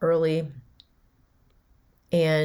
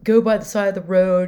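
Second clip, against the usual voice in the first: dramatic self-pity; 4 semitones higher, 6 dB louder than usual. Retold or imitated. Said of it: "he goes".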